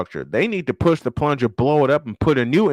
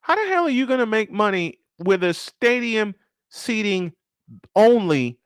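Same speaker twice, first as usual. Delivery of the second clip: higher exasperated question, landing hard on "only"; stress on only; 6 semitones higher than usual. Real, not acted. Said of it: "and I stress the word only".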